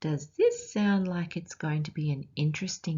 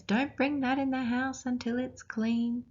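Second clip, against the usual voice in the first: higher, lightly voiced scolding mum; a hint of a stern mum, higher; bossy mum voice; 7 semitones higher than usual. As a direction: tell the kids off like a mum. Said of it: "and Mum says".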